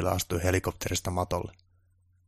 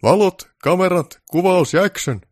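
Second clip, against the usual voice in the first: much higher, louder, slow call; grand film-set shout; director's shout; 11 semitones higher, 10 dB louder, slower than usual. Acted. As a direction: bellow it across the set. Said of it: "herra Baltasar huusi".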